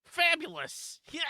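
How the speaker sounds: high-pitched Russie-style exclamation; Russie impression. Player does a Russie as requested, high exclaimed impression. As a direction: burst out high, doing a Russie. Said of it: "I did a Russie".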